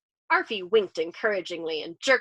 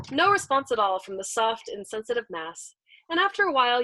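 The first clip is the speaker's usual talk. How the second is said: usual speaking voice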